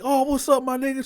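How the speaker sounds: high-pitched hearty hail; excited greeting. Retold or imitated. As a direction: hail an old friend, voice way up. Bo's acted greeting.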